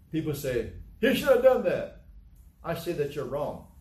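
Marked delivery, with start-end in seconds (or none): 1.0-2.0 high, loud mock protest; indignant objector, high and loud; mock objection. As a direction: blurt it as the protesting crowd. Acted retort from people.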